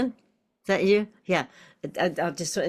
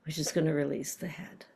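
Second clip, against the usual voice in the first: whisper; 6 dB quieter than usual. Real, not acted. Teacher whispering the key point.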